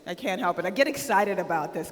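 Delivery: ordinary talk, nothing marked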